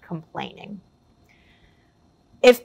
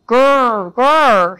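two short mock dog growls, louder; playful growl; 3 semitones higher, 5 dB louder than usual. Narrator playing Jake the dog, playful mock growls.

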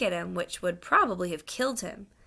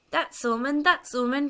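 high-pitched accusing outcry; excited cry; 7 semitones higher, 4 dB louder than usual. Acted. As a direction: cry out as the indignant fat man. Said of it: "cried the fat man".

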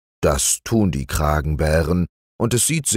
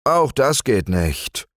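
disappointed; 7 semitones higher than usual. Acted. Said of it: "sagte er enttäuscht".

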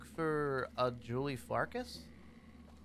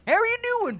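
much higher and louder, gushing; excited greeting; 10 dB louder, faster than usual. Pretend. Player greeting his robot pal, high and excited. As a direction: crank the joy way up.

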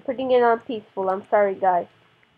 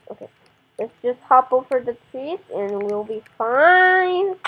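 ordinary delivery

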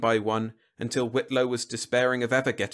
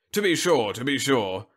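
usual speaking voice

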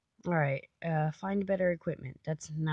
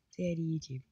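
normal talking voice

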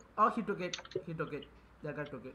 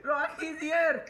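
high, slow words through laughter; laughing alarm; 8 semitones higher, much slower than usual. Real, not acted. Warning a friend of an enemy, laughing alarm. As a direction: call it out while giggling.

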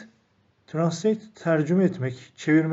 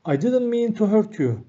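raised, careful model read of the English line; model sentence reading; 5 semitones higher than usual. Shown as a demonstration. Teacher reading the model sentence aloud, raised and clear. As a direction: read it out clearly for learners to copy. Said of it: "teacher models the English sentence".

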